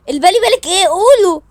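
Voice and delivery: high, loud demand; demanding shout. Acted. Sister loudly demands the siblings tell her the secret. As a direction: push them to spill it.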